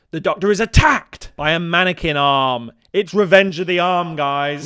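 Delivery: loud, dramatic B-movie announcement; hammed-up loud suspense climax; mock alarm. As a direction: blare out the attack like a horror trailer. Hammed-up sudden attack announcement.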